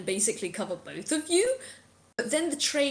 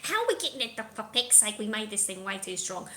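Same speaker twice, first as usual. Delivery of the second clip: bickering creature voices; 3 dB louder, faster than usual. Acted. Game master voicing trapped creatures blaming each other, bickering voices. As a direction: bicker in indignant put-on voices. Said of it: "in the background you are just hearing".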